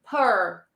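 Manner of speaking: "per" as a long r-coloured "pur"; isolated per sound. Shown as a demonstration. Teacher repeats the sound for students, modelled per. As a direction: draw out "per" like "purr". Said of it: "The sound is".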